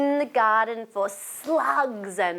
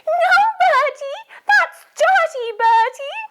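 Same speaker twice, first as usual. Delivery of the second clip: very high, loud scolding granny; grandma voice; 7 dB louder than usual. Acted. Grandma telling Bertie off for licking the dog, scolding. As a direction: tell him off as a squawking grandma. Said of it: "Can you do it in a grandma voice".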